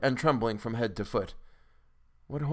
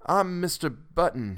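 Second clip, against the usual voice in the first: higher, slow, effortful introduction; barely managing words; 6 semitones higher, slower than usual. A narrator's reading. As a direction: struggle to get the name out. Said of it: "he managed to articulate".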